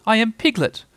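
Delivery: high, insistent little-animal voice; small creature insisting; loud protest. A narrator's reading. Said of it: "said Piglet loudly".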